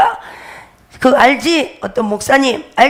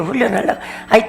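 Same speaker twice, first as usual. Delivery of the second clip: usual speaking voice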